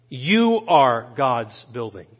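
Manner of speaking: slow, drawn-out "You" leading the line; stress on YOU. Real, not acted. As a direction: lean on "You", then let the rest fall. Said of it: "not about what you can build".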